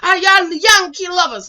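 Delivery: put-on loud, high Jamaican-accented jeer; imitated Jamaican taunt. Jilted boy taunting Yankee lovers in a loud Jamaican accent. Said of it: "This how they talk".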